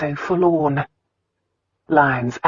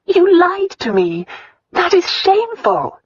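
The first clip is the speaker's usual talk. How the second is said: indignant; 4 dB louder than usual. Acted. Indignant accusation, high.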